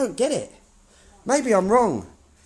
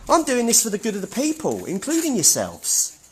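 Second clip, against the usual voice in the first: usual speaking voice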